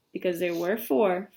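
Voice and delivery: ordinary delivery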